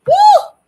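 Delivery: very loud, high whoop; mouthy woo. Retold or imitated. Showing how the speaker's mouth used to be, loud whoop. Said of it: "'Cause my mouth be".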